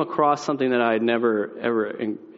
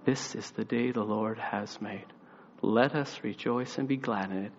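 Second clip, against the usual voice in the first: grandma's famous saying; 3 semitones lower, 8 dB quieter than usual. Retold or imitated. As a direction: recite it gently, like scripture. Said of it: "she had a famous saying".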